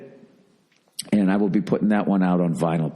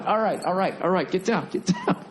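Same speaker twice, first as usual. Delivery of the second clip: pet talk; 10 semitones higher than usual. Real, not acted. Calming the jumping dog.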